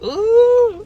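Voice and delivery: high, loud surprised ooh. Real, not acted. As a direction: react with a sudden high ooh. Reacting to her own look.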